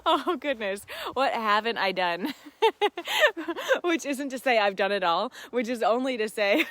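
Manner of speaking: giggling at her own answer; real laughter breaking into the reply; laughing. Real, not acted. Laughing at how much she's tried.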